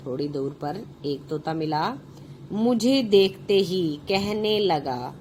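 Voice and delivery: ordinary talk, nothing marked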